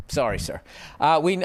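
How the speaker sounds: normal talking voice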